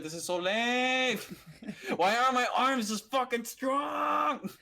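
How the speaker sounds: slow, loud, whining complaint; frustrated whine. Retold or imitated. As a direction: drag it out in frustrated disgust. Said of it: "I was like".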